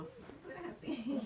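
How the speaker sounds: mock shock. Woman retelling her listener's shock at her past, mildly acted astonishment. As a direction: draw out the question as if taken aback. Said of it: "se quedó en, en shock".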